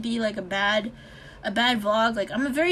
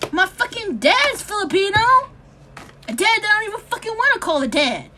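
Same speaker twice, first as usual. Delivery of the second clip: angry rant; 7 semitones higher, 6 dB louder than usual. Real, not acted. Angry rant opening the vlog.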